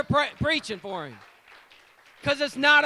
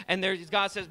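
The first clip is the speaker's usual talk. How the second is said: ordinary delivery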